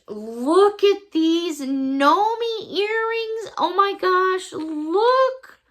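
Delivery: high, loud, delighted with a laugh; excited showing off. Real, not acted. Showing viewers the earrings, loud and high. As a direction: show off the treasure.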